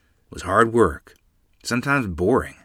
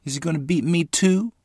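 higher, small anxious voice; frightened child; 8 semitones higher than usual. A narrator's reading. Lottie fears she is next, anxious high voice. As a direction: ask it as a worried little girl.

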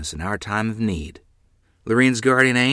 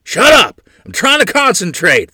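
furious shout, much higher and louder; angry yell; 11 semitones higher, 10 dB louder than usual. A narrator's reading. Father yelling at mother while driving the horses. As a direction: bark at her to shut up under pressure. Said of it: "Father yelled".

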